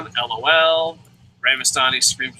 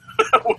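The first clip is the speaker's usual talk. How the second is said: high squeaky laugh; 4 dB louder than usual. Real, not acted. Laugh after friend-zone joke.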